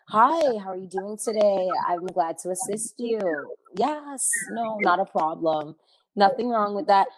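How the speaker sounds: slow, polished customer-service voice; measured, courteous work-call manner; professional phone voice. Shown as a demonstration. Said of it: "the professionalism voice comes on".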